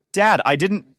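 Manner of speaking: loud panicked protest; desperate plea. A narrator's reading. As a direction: cry out to stop him. Pleading while being hit.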